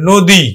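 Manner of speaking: নদী said "no-di", rounded o and short i; brisk teacher's "no-di" for নদী; short final I. Shown as a demonstration. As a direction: end নদী on a short "di", not a long "dee". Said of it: "রস্বইকার উচ্চারণ করি".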